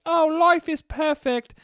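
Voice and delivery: put-on very high, slow sing-song child; happy little kid. The kid at the opening, high slow childish voice. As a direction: beam it out like a naive boy. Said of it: "the kid's like".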